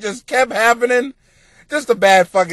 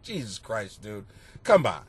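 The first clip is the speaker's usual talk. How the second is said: mild muttered groan; 11 semitones lower, 10 dB quieter than usual. Retold or imitated. Quoting his own weary reaction.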